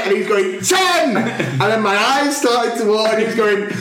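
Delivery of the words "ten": high-pitched shouted number; hammed-up intense count; mock loud countdown. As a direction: imitate a helper bellowing the count. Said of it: "he was getting louder".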